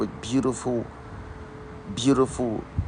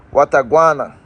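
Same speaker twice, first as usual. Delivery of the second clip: Jamaican patois greeting; 3 semitones higher, 5 dB louder than usual. Acted. Greeting his Jamaican family online, in upbeat Jamaican patois. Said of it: "To my Jamaican family".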